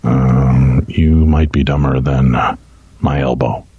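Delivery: hushed, low golf announcer put-down; golf commentator voice. Pretend. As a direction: murmur the insult like a golf commentator. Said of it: "verbally abuse him in my golf voice".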